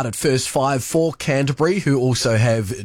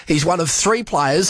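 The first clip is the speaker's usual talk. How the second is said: usual speaking voice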